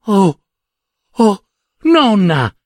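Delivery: a high, slow cry of longing; little girl crying out to her grandmother; cried out. A narrator's reading. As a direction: call out to the vision of grandma. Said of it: "gridò la piccolina".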